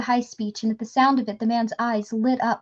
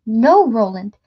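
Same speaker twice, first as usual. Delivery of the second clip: louder cry of alarm with a swoop up on NO; horrified cry; 7 dB louder, slower than usual. A narrator's reading. Woman sees too late what Roland means to do, horrified cry. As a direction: cry out to stop him. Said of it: "she cried, ... her own horrified voice".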